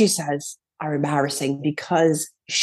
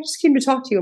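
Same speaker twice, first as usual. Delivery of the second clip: lightly mimicked mother, higher voice; a raised, protesting mom voice; defensive mother; 7 semitones higher, much faster than usual. Mother explains why she came along to the pedicure, defensive. Mother answering back in retold dispute.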